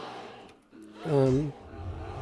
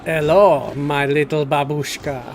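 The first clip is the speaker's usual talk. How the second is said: flirty Russian accent, lightly put on; a commercial's Russian guy charming a woman in a Russian accent; 4 dB louder than usual. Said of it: "he's, like, a Russian guy... trying to flirt with her".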